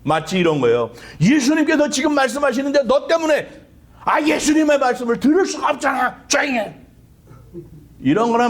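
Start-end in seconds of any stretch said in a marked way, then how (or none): 1.2-6.8 high-pitched, irritated telling-off; acted annoyed crowd member, voice raised in pitch; scolding. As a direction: scold a noisy bystander to shut up. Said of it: "시끄럽다고 야단을 쳤다고요".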